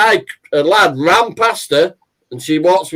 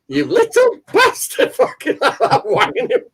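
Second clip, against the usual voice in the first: high-pitched, laughing mock yell; mum yelling at thief; 12 semitones higher than usual. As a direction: shriek it like an outraged old mum. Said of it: "and she went".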